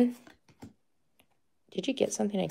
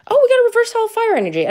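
excited; 14 semitones higher, 4 dB louder, much faster than usual. Real, not acted. Excited pull from a pack.

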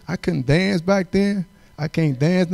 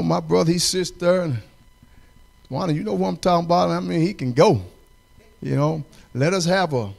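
ordinary delivery

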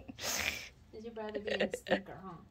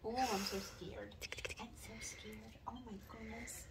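mock scared whisper; 7 dB quieter, slower than usual. Owner teasing the puppies, mock frightened whisper. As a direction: whisper it in playful fake fear.

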